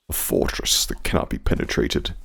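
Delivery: whispered like a movie trailer; soft, unvoiced trailer whisper; dramatic whisper. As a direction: hush it, full of awe. Dramatic reveal of the base.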